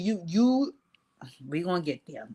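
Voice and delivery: ordinary talk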